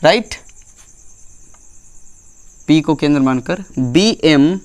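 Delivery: slow, spaced dictation for note-taking. Shown as a demonstration. Teacher dictating notes to the class, slow and spaced. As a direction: dictate word by word so pupils can write.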